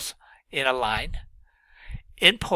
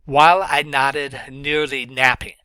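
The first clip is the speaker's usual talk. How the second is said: louder, N sounds leaned on in nodded, nearly, napping; alliteration demo; 6 dB louder than usual. Shown as a demonstration. Teacher showing students alliteration, stressing N. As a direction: punch every N. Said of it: "notice all the repetitions of the N sounds".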